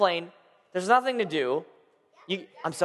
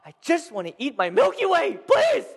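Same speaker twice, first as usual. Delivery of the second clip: impatient pleading; 5 semitones higher than usual. Retold or imitated. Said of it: "we're standing there like".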